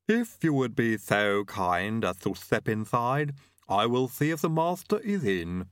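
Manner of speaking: slow, formal servant with a lisp; lisping butler Igor. Acted. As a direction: usher guests in slowly and formally, lisping.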